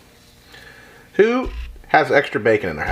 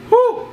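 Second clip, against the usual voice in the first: high whistle of heat; 4 dB louder than usual. Real, not acted. Hot food reaction.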